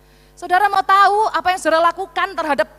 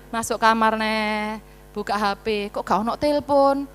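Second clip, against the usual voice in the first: normal talking voice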